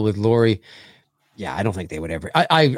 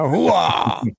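Al Pacino yell; 5 semitones higher than usual. Retold or imitated. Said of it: "he could yell his line".

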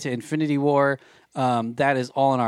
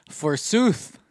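hammy stage actor; 5 semitones higher than usual. Acted. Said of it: "speak like Shakespearean".

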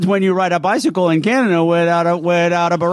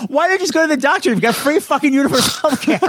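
exasperated rant; 10 semitones higher than usual. Retold or imitated. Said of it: "I'm like".

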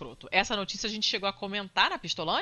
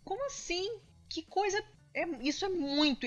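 rising, outraged disbelief; indignant disbelief; 7 semitones higher than usual. Real, not acted. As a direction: voice climbing in shocked outrage. Outraged reaction to deportation news. (indignação incrédula)

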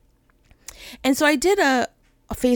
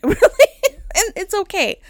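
laughing; 9 semitones higher, 4 dB louder than usual. Real, not acted. Laughing at her own crying.

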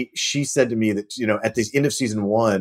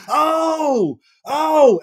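high, drawn-out "oh"; dawning realisation; 4 dB louder than usual. Retold or imitated. Actor learning of the planned relationship, exaggerated realisation. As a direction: act out the penny dropping, high-pitched.

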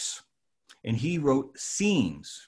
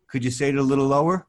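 imitating John; 8 dB louder, faster than usual. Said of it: "he says".

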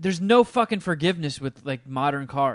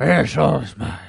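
loud gravelly devil voice; Satan voice; 6 dB louder, slower than usual. Retold or imitated. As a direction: boom it out like a Batman-style Satan. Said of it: "Satan sounds like Batman".

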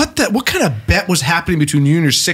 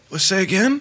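usual speaking voice

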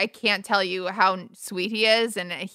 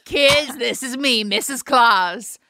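Mrs Claus voice; 4 semitones higher, 5 dB louder, slower than usual. Pretend. Playing Mrs Claus intro.

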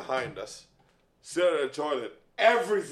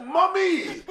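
high pleading wail; high wailing call; 14 semitones higher, 3 dB louder than usual. Retold or imitated. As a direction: squeal the word out. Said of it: "I'm like".

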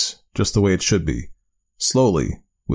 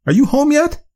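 high, raised call; 4 dB louder than usual. Acted. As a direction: call out into the house. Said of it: "she called out".

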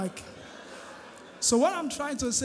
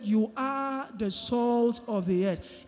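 slow scripture repeat; slower than usual. Shown as a demonstration. Preacher repeating Jesus's words to the church, slow and emphatic. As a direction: repeat it slowly so it sinks in. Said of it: "Jesus said".

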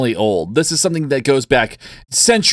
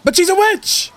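very high-pitched mock accusing cry; shouting crowd. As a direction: play a hysterical mob accuser. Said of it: "And the crowd shouts".

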